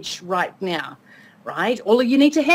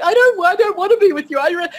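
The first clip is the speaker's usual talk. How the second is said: high, whiny-pleading imitation of a film heroine; high-pitched mock protest; mock lovers' protest; 7 semitones higher than usual. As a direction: act out a hammed-up romantic refusal. Acted lovers' quarrel from a film.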